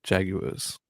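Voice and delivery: Jaguars echoed as "JAG-yoo-uhz"; American trying British pronunciation. Shown as a demonstration. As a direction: repeat Jaguars softly, British style. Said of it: "sounds good when an American says it".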